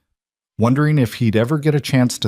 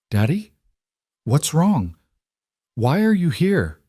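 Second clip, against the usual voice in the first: put-on worried little girl; 7 semitones higher, slower than usual. A narrator's reading. Daughter's voice in story.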